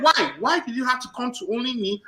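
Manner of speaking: high, indignant retold complaint. Customer challenging the bank security guard, retold indignation. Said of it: "I actually started raising my voice".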